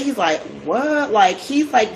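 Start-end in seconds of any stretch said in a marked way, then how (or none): 0.6-1.1 high-pitched mock-upset "what?"; upset disbelief. Yusuf hears they doubt him, mildly upset. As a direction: retell his mild, upset surprise. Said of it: "getting kinda upset".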